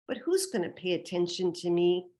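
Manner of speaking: ordinary delivery, nothing marked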